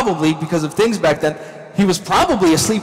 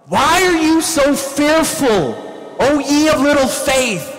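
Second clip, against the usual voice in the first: acted raised-voice rebuke; yelling over storm; 8 semitones higher than usual. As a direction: shout it so it carries through wind. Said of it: "Mind you, they're all yelling".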